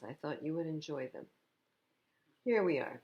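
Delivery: usual speaking voice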